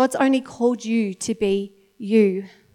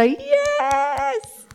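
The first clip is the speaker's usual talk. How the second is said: delighted cheer; 6 semitones higher, much slower than usual. Retold or imitated. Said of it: "he's actually gonna go".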